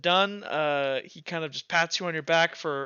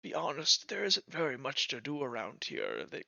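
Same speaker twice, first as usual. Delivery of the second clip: confiding lean-in; 5 dB quieter than usual. Acted. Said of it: "kinda leans down to you".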